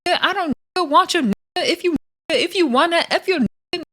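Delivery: imitating Big Gretch up high; a hammed-up, high-pitched Big Gretch; mock Big Gretch. Vlogger retelling Big Gretch's words to [name], high and mocking.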